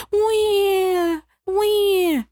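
high imitated baby wail wah wah; high mock baby cry. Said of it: "And then all of a sudden".

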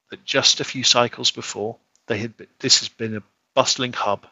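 usual speaking voice

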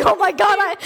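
horrified shriek. Retold or imitated. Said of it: "I was like".

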